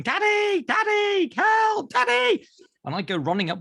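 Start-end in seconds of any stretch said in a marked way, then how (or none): 0.0-2.5 high-pitched mock scream for Dad; imitated child screaming. Imitating his son calling Daddy from bed, panicked screaming. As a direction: cry out like a panicked little boy. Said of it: "he'd start screaming".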